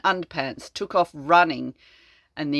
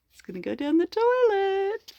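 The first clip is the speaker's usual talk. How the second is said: much higher, delighted prediction; gleeful giggle; 14 semitones higher than usual. Real, not acted. Reading to a child, gleeful about the flush. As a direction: squeal it with glee.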